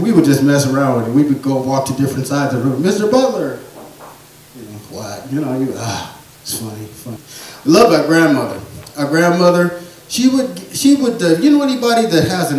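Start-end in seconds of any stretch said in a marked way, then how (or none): none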